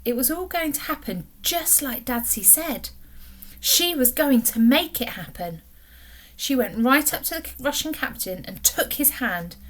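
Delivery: ordinary delivery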